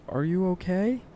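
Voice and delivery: high, rising call of concern; distant but clear. A narrator's reading. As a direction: call it from afar, pitch climbing to the end. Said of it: "distant but clear".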